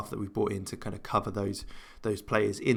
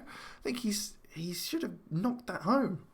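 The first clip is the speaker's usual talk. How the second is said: whispering; 9 semitones higher, 7 dB quieter, slower than usual. Real, not acted. Whispered regret at the miss.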